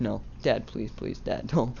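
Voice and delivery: soft, breathy pleading, whispered pleases; quiet terrified plea; scared begging. Retold or imitated. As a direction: beg your dad not to hit you. Begging dad with belt off.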